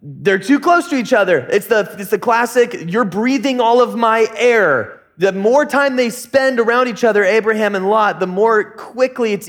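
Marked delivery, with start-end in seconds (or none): 2.9-5.0 put-on higher, slow, drawn-out kid gripe; whiny complaint. Child griping at sibling too close, mock whine. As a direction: imitate a bickering sibling, dragging it out.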